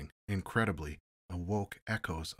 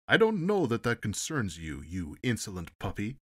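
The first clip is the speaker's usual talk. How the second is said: indignant; 4 semitones higher, 8 dB louder than usual. Acted. Ambassador insulted by questioning.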